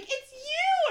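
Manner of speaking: very high starstruck squeal; excited fan. Retold or imitated. Fan comes over to the host, excited. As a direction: imitate a fan thrilled to spot you. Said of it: "she got all excited".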